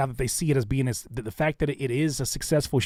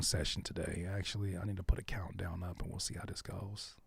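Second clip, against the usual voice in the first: whispering; 4 semitones lower, 14 dB quieter than usual. Real, not acted. Aside while setting up stream.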